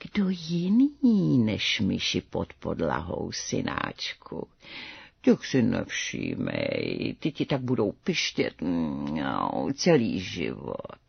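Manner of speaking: low, slow, soft cat mother; drawn-out, hushed feline purr to her son; meowing mother cat. A narrator's reading. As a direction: meow the words, stretching the vowels. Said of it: "černá maminka mňoukla".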